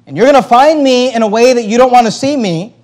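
high, loud stern threat; angry warning. Retold or imitated. Paul warning the Corinthian church, loud stern threat. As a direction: warn them sternly as Paul. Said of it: "voicing Paul's angry warning".

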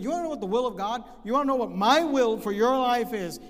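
loud, fast, pressing voice of God; God leaning in on you, insistent; preacher booming as God; voicing God. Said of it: "God looks at you and says".

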